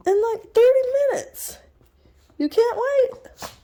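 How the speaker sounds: high sing-song talk to the dog; high pet voice. Real, not acted. Telling the puppy she must wait, high pet voice.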